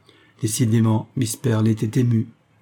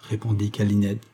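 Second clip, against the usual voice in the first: usual speaking voice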